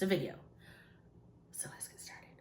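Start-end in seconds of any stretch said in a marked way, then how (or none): 1.5-2.3 hushed whisper to start the story. Real, not acted. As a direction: drop to a secret whisper. Sudden hush starting the story.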